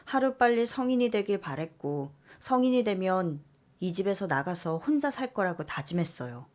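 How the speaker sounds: usual speaking voice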